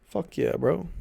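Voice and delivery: ordinary talk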